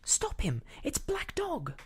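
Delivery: high-pitched cry of alarm; urgent cry. A narrator's reading. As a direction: call out to stop a fugitive. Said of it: "I cried".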